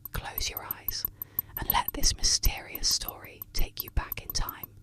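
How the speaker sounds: plain reading voice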